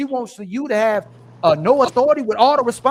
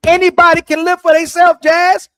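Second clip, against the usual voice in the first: loud, higher-pitched comeback; heated challenge; 5 semitones higher, 6 dB louder than usual. Real, not acted. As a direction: raise the voice to challenge Jazz. Challenging Jazz in argument.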